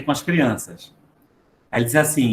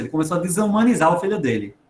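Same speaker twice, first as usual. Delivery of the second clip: ordinary delivery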